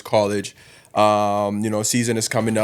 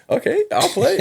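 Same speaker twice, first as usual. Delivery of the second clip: imitating his younger self in a much higher voice; mock high voice. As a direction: squeak it out like a shy kid agreeing. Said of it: "So I was just like".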